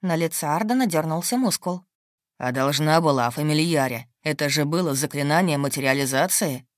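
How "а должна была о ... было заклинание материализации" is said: low, stern male voice. A narrator's reading. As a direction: lecture her, low and hard.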